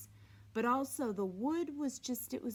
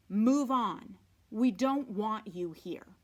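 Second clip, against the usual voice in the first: "Move on" pushed out louder, curt and cold; unwelcoming fence; 7 dB louder, slower than usual. Acted. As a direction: warn strangers off the property. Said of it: "which clearly said".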